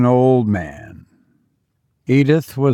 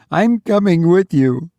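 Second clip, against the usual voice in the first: higher, firm woman voice; insist, higher pitched; insistent mother; 6 semitones higher than usual. Acted.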